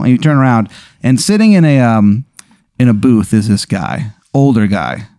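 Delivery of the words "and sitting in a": usual speaking voice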